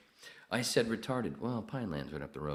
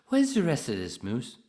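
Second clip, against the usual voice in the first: loud, higher-pitched movie impression; quoted movie character; 9 semitones higher, 12 dB louder than usual. Imitating a film line to Arthur, loud high character voice.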